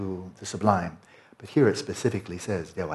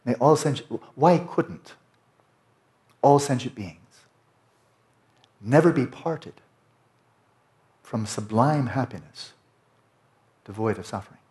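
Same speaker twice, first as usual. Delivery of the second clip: slow, higher, louder liturgical recitation; solemn liturgy reading; 4 semitones higher, 5 dB louder than usual. Shown as a demonstration. Teacher reads the liturgy aloud, slow and solemn. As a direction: intone it like a sacred verse. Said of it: "So here's the translation".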